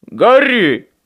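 loud, high warning call; shout back. A narrator's reading. Michael shouting back to Harry, loud high call. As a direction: yell the name back. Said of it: "крикнул в ответ Майкл".

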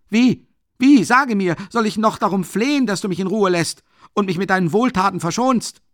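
calm start rising to a loud, high frenzy; building to rage. A narrator's reading. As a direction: start restrained and end screaming to be left alone. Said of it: "Er schloss aber in Raserei und fast erstickend".

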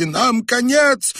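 very high, loud, drawn-out wail; slow, high wail of doom; panicked cry. A narrator's reading. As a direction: cry out in despair. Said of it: "позабыв об осторожности".

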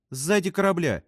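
high, triumphant announcement. A narrator's reading. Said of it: "торжествующе объявила Фрэнсис". (торжествующе, высоким голосом)

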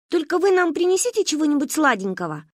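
put-on high little-girl voice begging for a treat; wheedling child. Little girl asks uncle for sweets, pleading. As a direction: coax the grown-ups for sweets like a small girl.

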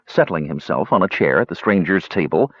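ordinary delivery

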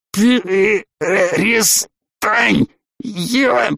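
high, strangled, very slow plea; choked plea. A narrator's reading. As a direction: gasp out a plea while being throttled. Man being throttled pleads.

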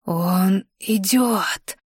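slow awed crone's murmur; very old faint voice. Acted. Old healer's awed prophecy. (медленный шёпот старой знахарки)

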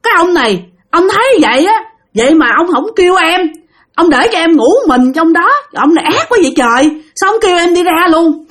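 acted-out reproach, pitched well up; retold scolding. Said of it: "bạn mới la".

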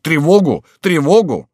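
loud, high repeated call; alarm cry. A narrator's reading. Townsfolk warning of the enemy, crying alarm loudly. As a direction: raise the alarm. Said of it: "закричали иные".

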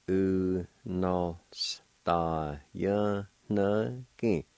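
drawn out very slowly, syllable by syllable; slow teaching pronunciation; slow syllable demo. Shown as a demonstration. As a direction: stretch each syllable of Unostajanagi slowly. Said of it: "And it's pronounced like this".

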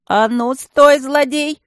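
loud booming stone voice; booming shout. A narrator's reading. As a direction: boom it out like a stone guardian. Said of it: "прогромыхал голос".

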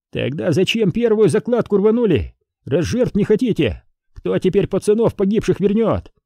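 high-pitched reproach; accusing challenge. A narrator's reading. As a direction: throw the blame back at the officer. Enemy accuses officer over dead. (обвиняющий упрёк высоким голосом)

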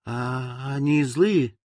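put-on slow, timid little girl; trembling voice. A narrator's reading. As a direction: ask it shakily, afraid. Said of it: "дрожащим голосом спросила девочка".

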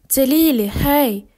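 loud, cheerful call through the door. Acted. Drunk Lily outside the door, calling loudly to be let in. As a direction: shout your name to be let in.

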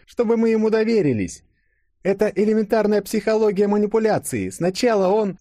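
very high, emphatic character voice; assertive Yulia. A narrator's reading. Yulia explaining the old man's manipulation to Denis, assertive. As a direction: argue back, pitched far above narration.

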